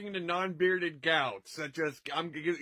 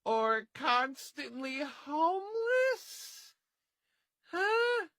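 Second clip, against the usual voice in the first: high mock voice; 9 semitones higher, slower than usual. Odd voiced punchline.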